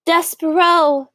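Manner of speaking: a father stunned to see his son; Lester, moved father. A narrator's reading. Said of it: "said Lester".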